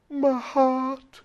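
high, loud, emotional mock voice; retold tearful reply. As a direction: imitate her as tears start. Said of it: "She started to cry".

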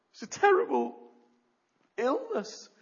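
high, loud horrified exclamation; mock horror. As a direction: act out horror at the disease. Horror at leprosy.

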